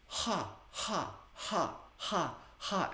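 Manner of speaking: short, high, breathy ha pulses; breathy ha exhales. Shown as a demonstration. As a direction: push each ha out on the breath. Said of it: "the exhale is gonna sound like this".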